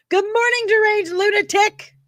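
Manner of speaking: high, loud wake-up holler; shouted greeting. Acted. Streamer throwing out a film-style greeting, shouted high. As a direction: holler it out like a manic morning host.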